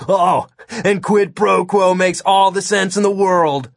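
high, mocking kid voice; snorting sarcasm. A narrator's reading. Kid throwing the agent's phrase back at him, scornful sarcasm. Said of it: "Todd snorted".

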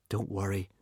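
soft, dejected; sad. A narrator's reading. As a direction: say it with a heavy heart. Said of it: "said Hiccup sadly".

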